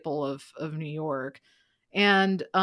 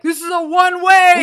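much higher, much louder yell; mock yell; 11 semitones higher, 13 dB louder than usual. Yelling at a truck driver, loud mock yell. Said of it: "I yelled at a truck once".

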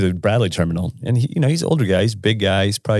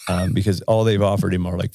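ordinary delivery

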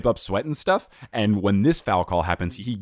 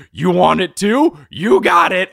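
much higher, louder umpire snap; mock umpire yell; 11 semitones higher, 9 dB louder, slower than usual. Retelling the umpire tossing the coach, loud high mocking yell. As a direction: bark it like a power-tripping umpire. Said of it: "the umpire said".

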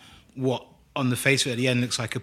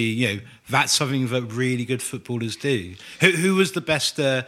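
usual speaking voice

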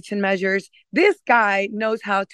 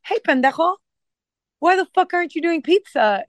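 cheeky ribbing; 8 semitones higher than usual. Retold or imitated. Retelling ribbing the chef friend.

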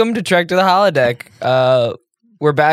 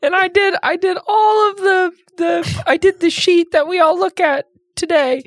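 fake Jan voice; 12 semitones higher than usual. Cohost fakes absent Jan's voice for a joke, high put-on voice. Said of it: "Jan, you don't sound like that".

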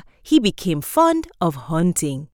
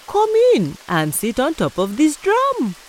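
trickster tale voices; 8 semitones higher than usual. Acted. Tortoise invites Afi.

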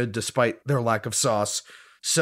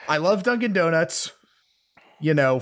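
ordinary talk, nothing marked

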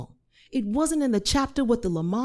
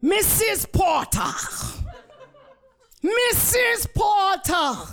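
put-on high, slow Jamaican-accented call; commanding Jamaican nurse, drawn out and high; thick Jamaican accent; 13 semitones higher, 3 dB louder, slower than usual. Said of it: "in a thick Jamaican accent".

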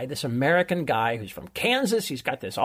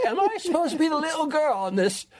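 higher, put-on voice of a baffled footballer; mock indignant player; 11 semitones higher than usual. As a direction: play the player, pitched up and put out. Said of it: "the guy is like".

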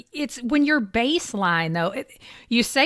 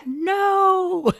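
high, drawn-out no; emphatic mock refusal; 8 semitones higher, 4 dB louder than usual. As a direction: stretch out a high, incredulous no. Mock answer to prayer bargaining.

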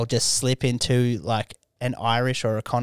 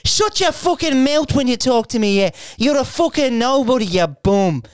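high, loud Irish McGregor rant; loud Irish accent, raging at a heckler; Conor McGregor; 12 semitones higher, 5 dB louder than usual. Retold or imitated. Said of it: "abused someone in the audience as Conor McGregor".

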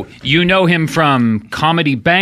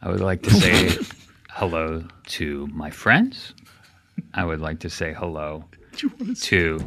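deadpan mock-formal shout-outs, lower and softer; mock solemn announcer; 10 semitones lower, 8 dB quieter than usual. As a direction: greet everyone like a grave dignitary. Formal mock greetings to everyone.

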